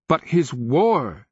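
slow, high, loud question; insistent protest. A narrator's reading. King protests to the slave.

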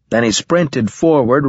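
usual speaking voice

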